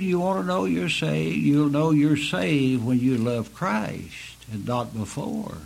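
normal talking voice

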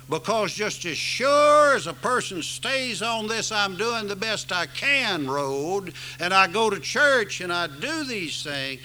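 rising, loud sermon climax; preaching at full force. Acted. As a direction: drive the gospel home from the pulpit. Preaching to the congregation.

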